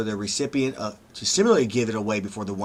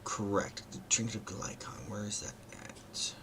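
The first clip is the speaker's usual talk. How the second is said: whispered to himself; whispering; 12 dB quieter, slower than usual. Real, not acted. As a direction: whisper it while searching. GM searching notes, muttering to himself.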